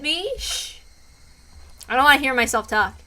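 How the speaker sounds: ordinary talk, nothing marked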